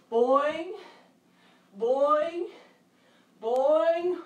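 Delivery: each one a bouncy hop; kangaroo boings. Shown as a demonstration. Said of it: "Let me hear some kangaroo sounds".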